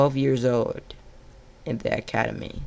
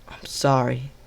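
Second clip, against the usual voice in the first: whispered apology; whispered grief; grieving whisper. Acted. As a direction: breathe the apology over her body. Grieving at wife's body.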